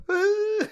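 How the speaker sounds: very high demonstrated mock cry; fake crying wail. Shown as a demonstration. Showing how to trick babies out of crying, fake wail. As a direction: let out a sudden pretend bawl. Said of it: "You just start going".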